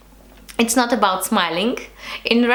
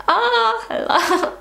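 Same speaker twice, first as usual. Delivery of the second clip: smiley greeting; 12 semitones higher, slower than usual. Retold or imitated. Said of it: "they more like".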